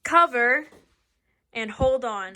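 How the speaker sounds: loud, spaced-out safety drill; emphatic commands. Acted. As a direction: call out each step firmly. Teacher drills earthquake safety.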